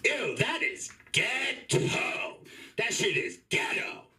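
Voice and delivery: high, very slow, drawn-out disgust; provocative mock disgust. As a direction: bait the listener with a put-on "Ew". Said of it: "sometimes I say really loud".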